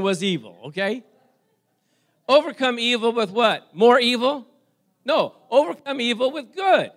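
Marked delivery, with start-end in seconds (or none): 2.3-4.5 louder, higher rhetorical question; preaching emphasis. Real, not acted. Preacher challenges the congregation, loud and rising. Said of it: "preaching to congregation". 5.0-6.9 higher and louder declaration; preaching emphasis. Real, not acted. Preacher answers his own question, loud and high. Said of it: "preaching to congregation".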